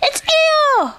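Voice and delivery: very high, loud squeal; terribly excited cry. A narrator's reading. Roo sees Eeyore in the river, terribly excited. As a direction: shriek it with excitement. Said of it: "cried Roo, terribly excited".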